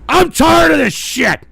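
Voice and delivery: high, loud, slow tirade; real rage, each phrase drawn out; furious shouting. Real, not acted. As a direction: bellow at the trolls, fed up. Berates trolls for their clips.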